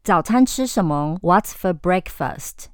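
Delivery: ordinary delivery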